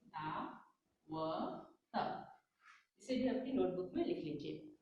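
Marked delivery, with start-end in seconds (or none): 0.1-1.7 दावत drawn out very slowly; slow dictation. Shown as a demonstration. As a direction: stretch दावत for the children to write. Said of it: "तो यहाँ पर लिखिए दावत".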